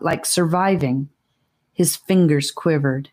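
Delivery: usual speaking voice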